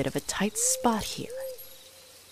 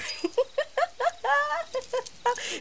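high mocking cackle at his expense. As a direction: cackle high and long, scornful. Mocking the hunter's outfit.

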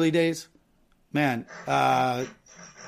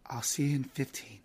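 hushed, secretive whisper; whispered hostess role; 3 semitones lower, 10 dB quieter than usual. Pretend. Host as hostess agreeing to meet the caller out back, whispering. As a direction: whisper it low as a secret arrangement.

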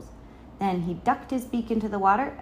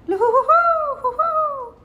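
high, loud hooting loon laugh; whooping bird cackle; laughing loon call; 8 dB louder than usual. A narrator's reading. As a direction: hoot wildly like a laughing loon. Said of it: "and the loon laughed".

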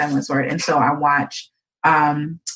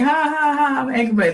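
funny angry laugh; 8 semitones higher than usual. Retold or imitated. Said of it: "like the funny".

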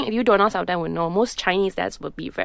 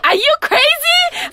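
high, slow shocked question; aghast at the remark; shocked disbelief; 5 dB louder, slower than usual. Retold or imitated. Reacting to fiancé's remark.

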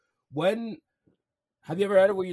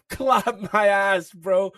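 laughing; 8 semitones higher, 7 dB louder, much slower than usual. Real, not acted. Laughing while retelling the slap.

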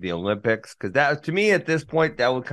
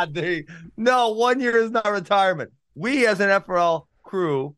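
wrestling promo; 7 semitones higher than usual. Pretend. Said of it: "I'm gonna cut a promo on you".

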